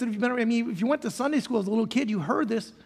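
ordinary delivery

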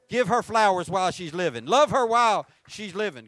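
loud, fervent exhortation; preaching at full force. Real, not acted. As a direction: drive the point home to the congregation. Sermon climax exhortation.

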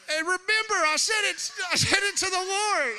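high, loud insistent shout; loud protest. Retold or imitated. A kid yelling his excuse to his dad, loud. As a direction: shout it out, defending yourself. Acting the child's defense.

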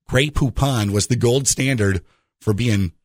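ordinary talk

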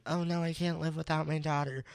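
high, quick mock lament; imitating distraught mother. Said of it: "And she's like".

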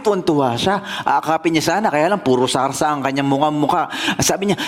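ordinary delivery

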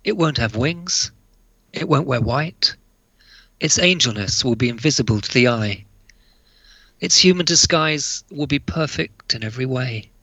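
measured recital with long pauses between lines; poem reading. Acted. Author reading his angel poem to listeners, hushed-ending poem recital.

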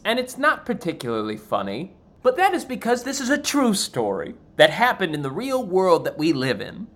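ordinary delivery

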